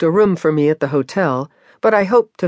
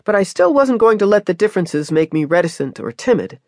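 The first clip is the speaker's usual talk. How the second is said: ordinary delivery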